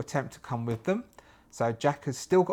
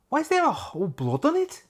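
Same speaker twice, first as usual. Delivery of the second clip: much higher, eager put-on voice; high pleading Jack; faster than usual. Jack begs Staff to let him come, in a high eager voice. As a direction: beg to come along, pitched up and eager.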